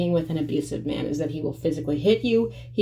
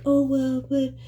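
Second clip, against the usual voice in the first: mock guilt-trip; 7 semitones higher than usual. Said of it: "They'll be like".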